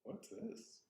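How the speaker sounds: soft, high rising question; curious discovery. Acted. Zen practitioner finding ripe apples, softly curious. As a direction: ask with hushed, high-pitched wonder.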